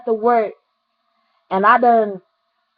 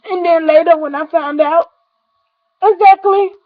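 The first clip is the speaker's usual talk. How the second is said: real crying; 6 semitones higher, 5 dB louder than usual. Real, not acted. Remembering her friend who died.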